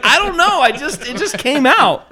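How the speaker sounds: hammed-up embarrassed excuse; retold helpless protest. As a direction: plead helplessly in a high voice. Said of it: "I'm like".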